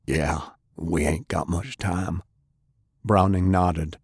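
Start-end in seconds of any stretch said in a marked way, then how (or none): 0.1-2.2 wounded heavy. Acted. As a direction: drag it out, tired and hurt. Sullivan answers.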